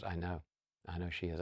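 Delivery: hushed, resigned acknowledgement; subdued admission. Retold or imitated. Mom resignedly admits daughter's trouble.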